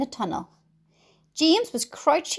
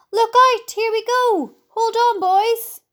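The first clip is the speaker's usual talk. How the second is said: high, loud warning cries; excited shouting; 11 semitones higher, 5 dB louder, slower than usual. A narrator's reading. Crew reacting as the peach lifts, excited shouting. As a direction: holler for everyone to hang on.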